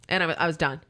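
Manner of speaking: ordinary talk, nothing marked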